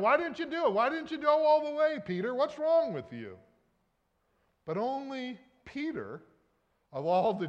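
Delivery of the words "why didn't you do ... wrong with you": mock scolding. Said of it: "and say".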